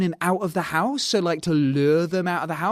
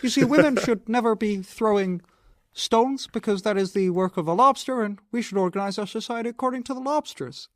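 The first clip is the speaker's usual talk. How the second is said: higher, puppet-like lecturing voice; Kermit-style Peterson; 4 semitones higher than usual. Pretend. Mocking Peterson's lecture in a Kermit voice. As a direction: preach about lobsters as Kermit. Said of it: "Kermit the Frog more polite".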